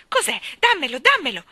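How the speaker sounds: high, loud, eager grab; trembling with excitement. Acted. Jane begging the housekeeper for the parcel, excited. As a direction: cry out, desperate to have it. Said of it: "tremando per l'emozione".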